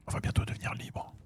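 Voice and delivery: breathy whispered aside. Real, not acted. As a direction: whisper it secretly.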